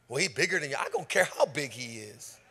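ordinary talk

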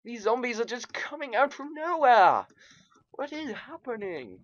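high, wailing disbelief; bewildered alarm. Real, not acted. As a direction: whine in alarm at being swarmed. Overwhelmed by zombies in game.

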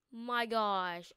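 ordinary talk, nothing marked

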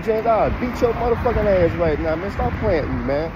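high, heated threat to the doctor. Retold or imitated. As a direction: shout him down, pitch way up.

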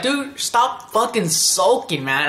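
high, drawn-out mock scolding; exasperated scolding. Naruto telling Sasuke to stop sulking, exasperated scolding.

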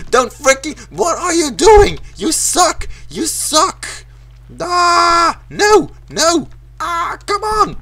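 high-pitched raging protest; frustrated shrieking. Real, not acted. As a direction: wail at the game in a high voice. Level kills him, protests.

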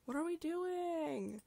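high, loud mock shriek. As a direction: shriek it like a startled cat. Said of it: "she's like".

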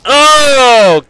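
high, loud, drawn-out crow; triumphant whoop. Real, not acted. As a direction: whoop over the kill. Crowing over a kill.

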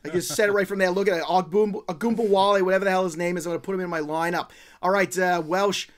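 put-on terrible Boston accent. Host mocking co-host's lineup pick in a loud Boston accent. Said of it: "it's a terrible Boston accent".

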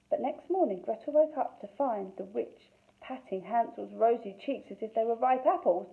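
usual speaking voice

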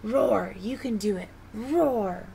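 plain reading voice

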